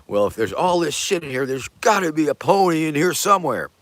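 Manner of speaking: lightly imitated child's excitement; eager kid. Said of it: "And the kid says".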